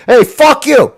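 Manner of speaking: mock New York tough-guy accent. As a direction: snap it like a New Yorker. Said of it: "the imitate a Mexican".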